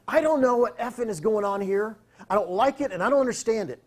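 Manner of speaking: spitting out confusion angrily; a touch of a furious boss; retold angry rant. Angry producer in his face.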